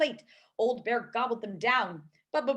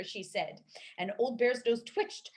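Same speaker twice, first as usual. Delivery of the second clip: usual speaking voice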